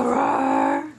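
playful growly roar, drawn out; lion roar. Pretend. Mother repeats the lion game for baby, playful roar. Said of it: "Do you go".

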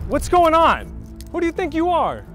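loud, high-pitched protest; indignant outburst. Acted. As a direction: demand answers, outraged. Officer startled by turn of events.

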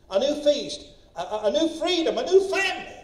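building list, climbing to a high, loud peak; rousing preaching. Real, not acted. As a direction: raise the pitch with each new item.

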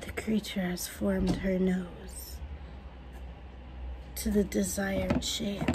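hushed documentary narrator; nature documentary narrator. Pretend. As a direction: narrate like a wildlife film. Continues documentary whisper.

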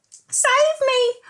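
high desperate cry for help. Acted. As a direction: wail for rescue. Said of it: "cried Carrot".

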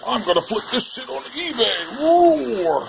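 high, put-on stuffed-tiger voice; Tiger Blanket voice. Stuffed tiger plotting to resell, high toy voice. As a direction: squeak it out like a scheming toy. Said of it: "He's like".

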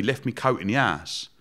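ordinary talk

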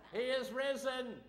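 loud, high call pushing for a response. Shown as a demonstration. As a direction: rouse them with a big shout. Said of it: "that's for them up there".

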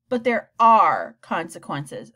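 slow, firm and weighty; stern warning. A narrator's reading. Principal warning the mother, slow and stern. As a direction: lay down the consequences.

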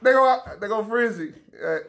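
calling out. Real, not acted. Calling out to class.

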